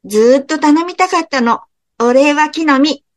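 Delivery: put-on a child squirrel blurting out its wish; eager young squirrel. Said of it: "子リスが飛び上がって言いました". (高い声で跳ねるように話す子リス)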